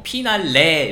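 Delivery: 피날레 as Korean "pi-nal-le"; Koreanized 피날레, no English F; Korean P sound. Shown as a demonstration. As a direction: say 피날레 with P and a final "eh". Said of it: "한국어로 P 발음으로".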